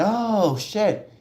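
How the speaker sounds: high startled exclamation; shocked oh shit. Retold or imitated. Fans reacting to the ring, shocked and high. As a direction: gasp it out like you just spotted it. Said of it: "So people said".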